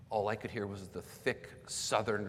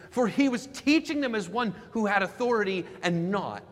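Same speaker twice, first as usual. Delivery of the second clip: higher, louder pulpit delivery; impassioned preaching; 8 semitones higher, 8 dB louder than usual. Real, not acted. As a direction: proclaim it to the whole congregation. Preaches at raised force.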